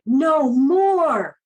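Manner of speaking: playful mock demand, drawn out; insistent child. Boy insists on more air.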